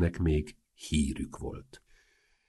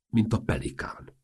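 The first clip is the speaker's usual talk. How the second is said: ordinary delivery, nothing marked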